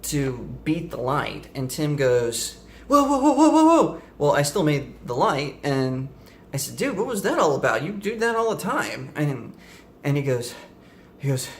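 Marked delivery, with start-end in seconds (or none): 2.9-4.0 high, loud panicked whoas; alarmed whoa. Retold or imitated. Friend in passenger seat yelping at the speeding driver, panicked. 6.8-9.1 baffled. Retold or imitated. Narrator baffled at friend's panic.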